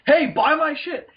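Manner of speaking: high, slow mock sales pitch; pushy hawker. Mocking the seller who jumped in front of him, high slow pitch. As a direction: ham up a street hustler's call.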